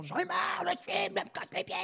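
high-pitched imitation of a fed-up actress screaming; retold exasperated yell. Actress shrieking her exasperation on a jungle film set, high and strained. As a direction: squeal it out like an actress at the end of her rope. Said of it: "et elle hurle".

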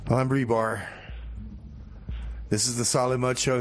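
usual speaking voice

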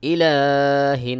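slow chanted ইলাহিন; recitation. Acted. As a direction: intone it. Recites word.

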